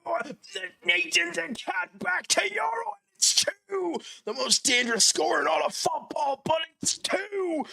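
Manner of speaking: screamed goal celebration; excited shout. Real, not acted. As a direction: yell it with joy.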